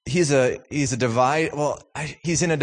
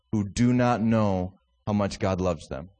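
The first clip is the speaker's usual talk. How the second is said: ordinary talk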